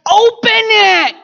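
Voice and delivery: God urging people, loud; urgent call. Retold or imitated. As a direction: plead with them to open the gift. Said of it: "He's like".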